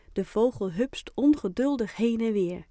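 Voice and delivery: usual speaking voice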